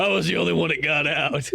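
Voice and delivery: put-on survivor voice with a laugh; mock the hardened mom, laughing by the end; imitated jaded mom. Mom tells Grace she alone escaped, mock-jaded voice. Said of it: "her mom's just, like, sitting there".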